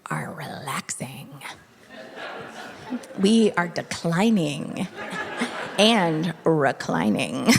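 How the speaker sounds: whispered, slow and low; sleepy whisper. Acted. Joking about turning fifty, slow whispered drowse. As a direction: melt into the couch as you say it.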